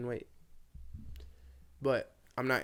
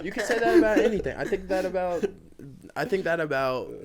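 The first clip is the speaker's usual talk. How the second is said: insistent; 6 semitones higher, 7 dB louder than usual. Real, not acted. Arguing back, raised voice.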